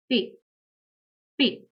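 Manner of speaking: P said as "peh"; German letter P. Shown as a demonstration. Teacher showing learners the German alphabet, P as "peh". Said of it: "is pronounced as".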